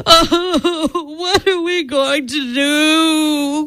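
half-weeping mother's plea; distressed cry. Acted. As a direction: wail it like a worried mother. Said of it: "cried Missus Cream".

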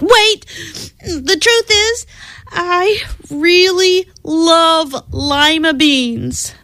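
put-on urgent girlish plea; desperate confession. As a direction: blurt it before she leaves. Camilla finally confesses.

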